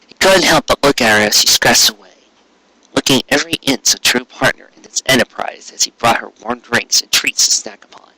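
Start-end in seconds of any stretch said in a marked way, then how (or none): none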